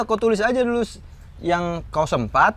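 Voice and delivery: ordinary talk, nothing marked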